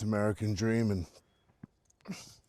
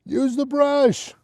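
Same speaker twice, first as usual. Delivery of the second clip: doggy talk; 13 semitones higher, 6 dB louder, slower than usual. Real, not acted. Talking to a dog.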